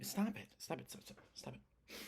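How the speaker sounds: very soft, off-mic telling-off, fading to a whisper; hushed scolding. Real, not acted. Speaker to cat playing with cords, hushed scolding. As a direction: shoo a pet away under your breath.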